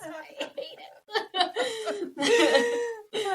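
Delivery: high, laughed-out admission; laughing confession. Real, not acted. Parent owning up to hating child's game, laughing. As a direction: admit it, dissolving into laughter.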